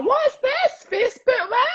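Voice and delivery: put-on high, baffled old granny voice; confused granny. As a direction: squeak it out like a lost granny online. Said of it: "So you go like that".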